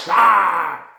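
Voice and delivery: loud, high outburst of anger; mad yell. Acted. Showing viewers getting mad, loud angry yell. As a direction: let out an angry "ah". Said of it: "that's when I get so mad".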